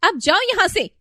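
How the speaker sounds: loud, high, furious command to leave; angry order. Acted. Daughter-in-law loudly ordering her mother-in-law out, furious. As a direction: spit it out, throwing her out.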